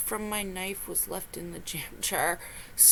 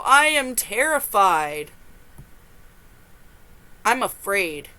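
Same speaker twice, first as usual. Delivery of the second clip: usual speaking voice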